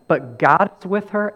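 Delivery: ordinary delivery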